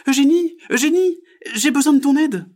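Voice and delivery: very high, loud call; little frog calling. Acted. Frog calls magpie for help, very high and loud.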